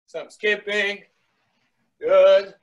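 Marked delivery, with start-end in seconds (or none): 2.0-2.5 loud praise. Real, not acted. Coach praising drills.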